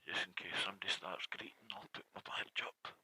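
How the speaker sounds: hushed, conspiratorial murmur; whispered aside. Real, not acted. Vlogger whispering about the blue badge. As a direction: whisper it to yourself.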